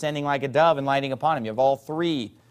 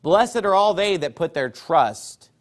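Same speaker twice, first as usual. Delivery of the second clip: raised, ringing scripture reading; solemn pulpit reading of the King James text; preacher reading scripture; 5 semitones higher than usual. Acted. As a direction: proclaim the psalm to the congregation. Reads Psalm two aloud.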